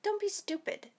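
high-pitched, defensive brush-off; forced dismissiveness. A narrator's reading. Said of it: "forcing a fake laugh".

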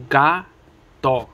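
gato broken into GA, pause, TO; split into syllables. Shown as a demonstration. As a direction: say gato one syllable at a time. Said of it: "each segment that is broken up".

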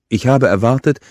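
indignant words with a full mouth. A narrator's reading. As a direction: talk round a bite of food. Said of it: "sagte sie mit vollem Mund".

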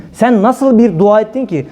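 ordinary talk

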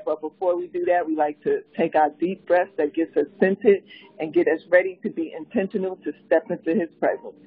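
ordinary talk, nothing marked

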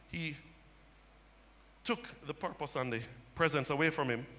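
ordinary delivery

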